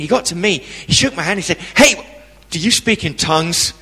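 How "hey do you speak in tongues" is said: loud, bright-up Hey, then breezy question; breezy American guest. Retold or imitated. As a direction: greet him too eagerly, then blurt the question. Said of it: "he said it just like that".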